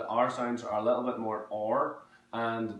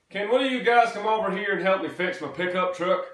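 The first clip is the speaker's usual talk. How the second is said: broad American South, pitched up and loud; Texan accent; 9 semitones higher, 9 dB louder than usual. Shown as a demonstration. Texan asking guys for help with a truck, Texan accent. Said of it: "go a little bit more Texan".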